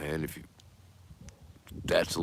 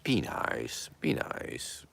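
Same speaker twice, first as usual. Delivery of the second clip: be nice, fading from a murmur down to a whisper; soft urging; 7 semitones lower, 9 dB quieter, much slower than usual. Acted. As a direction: hush it down to a breathy plea.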